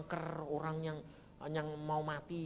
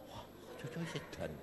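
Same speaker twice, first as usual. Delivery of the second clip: whispered aside; 9 dB quieter than usual. Real, not acted.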